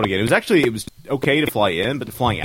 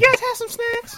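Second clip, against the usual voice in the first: very high mock Texas squeal; hammed-up drunk Texas woman; annoying drunk Texan. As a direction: pester them in a squeaky Texas party-girl voice. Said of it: "almost as annoying as she was".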